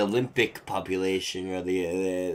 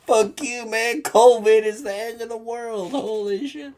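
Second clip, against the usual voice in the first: high, loud hammed-up outburst; mock panicked friend; 13 semitones higher, 3 dB louder than usual. Friends panicking about covid, hammed-up high yell. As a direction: play the buddy freaking out about the end of the world. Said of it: "And like".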